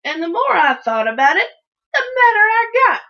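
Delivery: loud, climbing to a high peak; mounting, worked-up fury; building anger. A narrator's reading. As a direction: let the temper rise with each phrase. Narrator's anger building to outburst.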